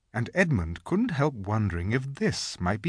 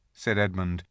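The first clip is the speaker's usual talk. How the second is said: ordinary delivery, nothing marked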